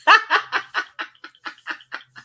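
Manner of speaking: a burst of high laughter. Real, not acted. Laughing at own joke.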